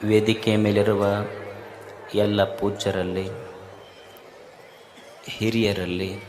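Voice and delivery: soft, low, devout opening greeting; solemn invocation. Acted. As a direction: call on the guru reverently before the talk.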